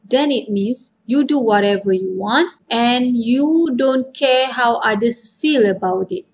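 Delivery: ordinary talk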